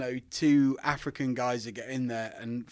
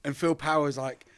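usual speaking voice

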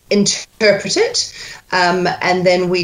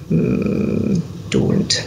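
ordinary talk

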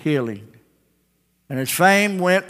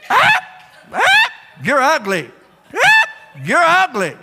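parrot taunt; 13 semitones higher, 5 dB louder than usual. Acted. Parrot jeering at a passerby, high squawk.

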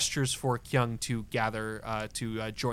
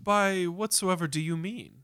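higher, politely puzzled director; lightly voiced theater director; 6 semitones higher than usual. Director questioned about the performance, lightly voiced innocence, pitched up. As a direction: play the courteous host feigning innocence.

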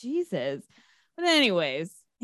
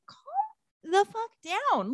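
very high, drawn-out, softer exasperation; exasperated; 13 semitones higher, 5 dB quieter, slower than usual. Acted. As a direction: stretch it out high in mock disbelief. Reacting to the mother's unreasonable anger.

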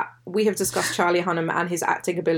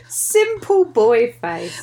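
slow amused echo; 4 semitones higher, 4 dB louder, much slower than usual. Real, not acted. Echoing friend's phrase after laughing.

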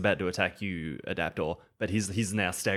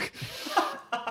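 high unvoiced laugh; 3 dB quieter than usual. Real, not acted. Player laughs at GM.

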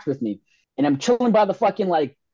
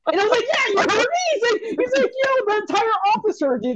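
pitched way up, telling it breathlessly; excited high retelling; faster than usual. Retold or imitated. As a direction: relive the thrill.

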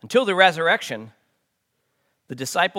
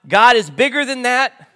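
emphatic Peter; 4 semitones higher, 10 dB louder than usual. Retold or imitated. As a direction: push back hard like a loyal friend. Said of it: "And Peter says".

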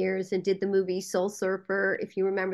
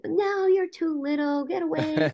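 higher-pitched imitation of kids shooing someone; lightly mock a bunch of boys brushing off a little one; dismissive boys; 7 semitones higher than usual. Boys rejecting a younger child.

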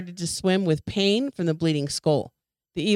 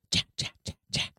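unvoiced eerie whisper of the name; ghostly whisper; 7 dB quieter than usual. A narrator's reading. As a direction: breathe the name like a spirit in the fog. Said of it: "the voice hissed softly".

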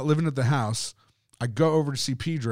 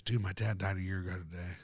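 breathy near-whisper, much softer; whispering the eerie news to his friend; hushed stunned whisper; 7 semitones lower, 12 dB quieter than usual. Retold or imitated. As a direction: hush it like a chilling reveal. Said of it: "And he goes".

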